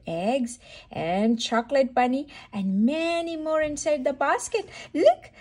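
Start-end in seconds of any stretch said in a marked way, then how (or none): none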